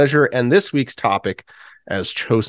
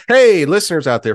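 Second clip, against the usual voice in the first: loud, high hail to the audience; calling out; 8 semitones higher, 5 dB louder than usual. Real, not acted. Podcast host asking listeners for an invite, calling out. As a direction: call out to get their attention.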